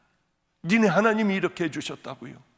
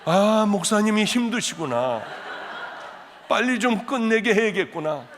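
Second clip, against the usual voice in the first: lightly voiced sympathetic churchgoer, higher and louder; playful pretend congregation; 6 semitones higher, 5 dB louder than usual. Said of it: "이런 생각하지 마시고".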